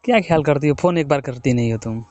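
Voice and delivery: ordinary talk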